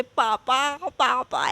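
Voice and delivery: loud call of papa, papa; retold yelling for papa. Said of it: "ऐसे चिल्ला रहे". (डर के मारे पापा को ज़ोर से पुकारना)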